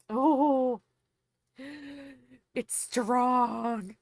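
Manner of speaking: loud, slow coffee exclamation; excited. Real, not acted. Tasting strong coffee.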